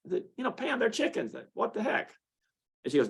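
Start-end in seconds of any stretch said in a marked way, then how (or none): 0.4-2.1 mock exasperated. His own retold reply.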